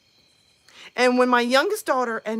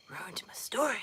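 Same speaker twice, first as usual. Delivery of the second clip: sulky unvoiced whisper; 9 dB quieter than usual. Real, not acted. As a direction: grumble it under breath. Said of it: "sulking aside".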